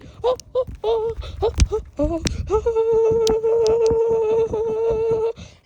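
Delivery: high, loud laugh held out long; laugh, then stretch it into a long high wail; drawn-out cartoon laughing; goofy laugh. Goofy outburst after announcement.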